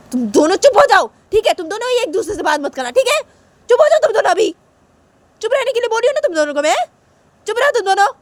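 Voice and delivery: high, loud motherly telling-off; acted exasperated mother; angry scolding. Mother scolds both children.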